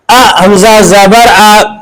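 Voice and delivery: slow, drawn-out reading; slow recitation. Shown as a demonstration. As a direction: read the syllable slowly to show it. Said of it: "इजरा कैसे करेंगे?". (धीमे स्वर में पढ़कर दिखाना)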